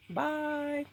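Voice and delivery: ordinary delivery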